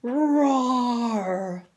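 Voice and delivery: big loud drawn-out roar; tiger roar. Shown as a demonstration. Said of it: "Let's say that together".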